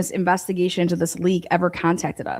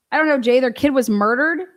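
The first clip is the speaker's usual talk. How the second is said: exasperated retort; 6 semitones higher, 4 dB louder than usual. Retold or imitated. Voicing the exasperated answer to reporter.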